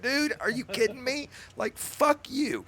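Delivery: high-pitched mock disbelief; incredulous. Moderator telling the troll off, high incredulous. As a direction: play it like you can't believe the nerve.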